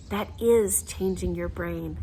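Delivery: ordinary delivery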